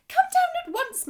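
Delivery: high, loud eager call; excited call. A narrator's reading. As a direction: call him down, thrilled. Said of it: "shrill with excitement".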